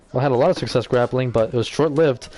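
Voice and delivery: ordinary delivery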